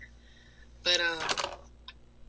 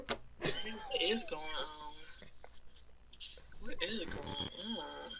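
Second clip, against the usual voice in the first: mock dramatic murmur; 10 dB quieter, much slower than usual. Mock dramatic echo of friend.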